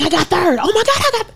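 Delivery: very high, thrilled cry building up; ecstatic shout. Retold or imitated. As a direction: scream your joy at making the podium.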